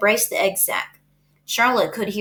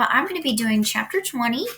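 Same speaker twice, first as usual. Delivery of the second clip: plain reading voice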